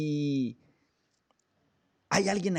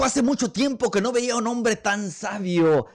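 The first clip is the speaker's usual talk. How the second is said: admiring king; 8 semitones higher, 5 dB louder than usual. Acted. King marvels at wise man.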